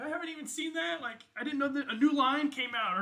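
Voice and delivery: high, loud, starstruck gush; gushing party guest. Acted. Party guest gushing over the player's armor, high and loud.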